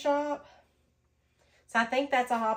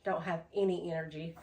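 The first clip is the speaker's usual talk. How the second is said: out of breath; 5 semitones lower, 6 dB quieter than usual. Real, not acted. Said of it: "I'm sorry if I'm getting out of breath".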